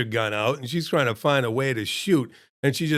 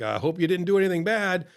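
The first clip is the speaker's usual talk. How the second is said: joking around; 6 semitones higher than usual. Retold or imitated. Joking with the trainee in front of the cop, playful and higher. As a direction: tease him lightly, voice lifted. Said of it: "just kinda joking around".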